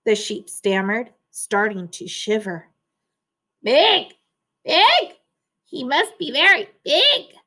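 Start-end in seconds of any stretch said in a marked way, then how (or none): 3.6-7.3 high, loud, flustered sputter; sputtering duck. A narrator's reading. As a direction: blurt it out in a panic. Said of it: "Duck sputtered".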